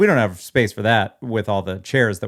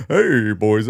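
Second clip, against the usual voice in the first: Santa voice; 3 dB louder than usual. Pretend. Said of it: "What does Santa sound like?".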